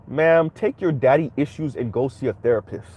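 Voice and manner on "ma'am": usual speaking voice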